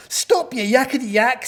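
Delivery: loud, high, impatient telling-off; exasperated story-animal command; scolding. A narrator's reading. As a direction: shush a chatterbox sharply. Gnu scolds the chattering yak.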